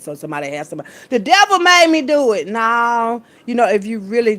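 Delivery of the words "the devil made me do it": loud, high mocking quote; hammed-up, loud blame-shifting; mock excuse. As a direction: cry it out like a big showy excuse.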